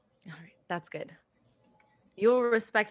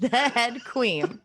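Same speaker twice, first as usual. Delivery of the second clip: mock royal; 4 semitones higher, 3 dB louder, much slower than usual. Finishing the royal hail.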